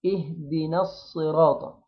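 اهدنا الصراط recited slowly, the alif of نا not lengthened; slow, careful tajweed reading of اهدنا الصراط; slow recitation demo. Shown as a demonstration. Teacher demonstrating to students slowly. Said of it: "فنقول إيه".

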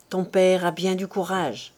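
usual speaking voice

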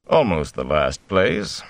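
slow, dry aside; deadpan, drawn out. A narrator's reading. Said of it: "dryly added".

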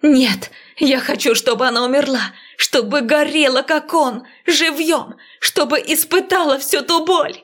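furious rising cry. Acted. Heroine rages for revenge.

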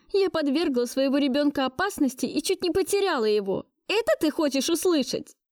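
high, agitated self-accusation; bitter outburst. Acted. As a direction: throw the guilt back at the listener. Angry self-accusing outburst at him. (надрывно, высоким голосом)

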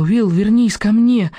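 loud, high, slow begging; desperate plea. A narrator's reading. Stella begs dying Will to come back, loud and slow. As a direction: beg him to come back.

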